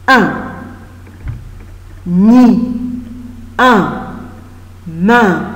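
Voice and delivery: slow, separate syllables, each one clear; syllable reading drill. Shown as a demonstration. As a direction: read each syllable alone, with pauses between. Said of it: "vous allez lire des syllabes". (lecture syllabe par syllabe, lentement)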